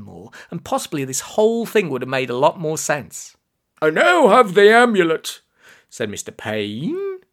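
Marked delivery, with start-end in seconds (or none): 3.8-5.4 high, loud villainous announcement; gloating villain. A narrator's reading. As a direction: declare triumph like a pantomime villain. Villain announces his triumph in story.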